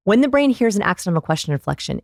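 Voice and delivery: ordinary delivery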